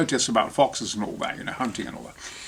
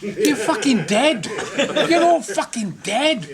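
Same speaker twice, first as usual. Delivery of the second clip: scornful disbelief; 8 semitones higher than usual. Retold or imitated. His own scornful retold thoughts.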